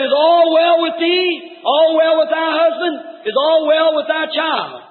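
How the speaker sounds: high, slow, ringing questions; preached prophet's questions. Acted. Prophet questioning the grieving Shunammite woman, slow preaching cry. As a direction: call out each question, drawing it out.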